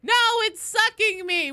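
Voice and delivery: high, loud mock-panicked yell; hammed-up shriek of protest; imitated scream. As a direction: act out your own scream in protest. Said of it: "I always scream".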